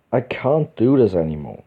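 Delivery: ordinary delivery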